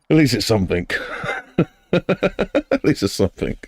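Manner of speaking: words breaking into real laughter; amused, laughing as you talk. Real, not acted. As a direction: say it through a fit of giggles, voice rising. Cracking up at own commentary.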